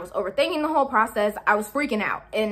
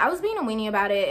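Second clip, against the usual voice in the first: ordinary delivery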